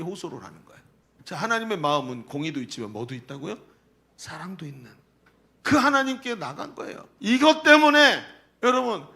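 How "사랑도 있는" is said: breathy hushed whisper of the answer. Real, not acted. Preacher answering his own question to the congregation, hushed whisper. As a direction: let the word love fall to a whisper.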